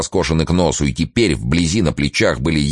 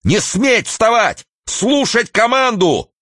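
high, slow, piercing yell; piercing shout; slower than usual. A narrator's reading. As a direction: bellow the order in a shrill cry. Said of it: "закричал своим пронзительным голосом".

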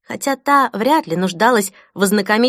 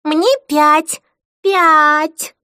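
put-on high, louder child's voice; mocking little girl; 9 semitones higher, 6 dB louder, much slower than usual. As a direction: tease like a small girl mimicking mom. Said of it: "Хлоя передразнила мать".

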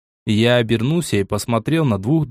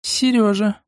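высокое, протяжное «Серёжа»; тянет имя, ласкаясь к жениху; сладко; 10 semitones higher, slower than usual. A narrator's reading. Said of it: "протянула Лайза сладким голосом". (сладким голосом, нараспев)

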